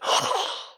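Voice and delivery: high, sharp mock gasp; hammed-up gasp of scandal. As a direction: gasp in fake shock. Mock media shock.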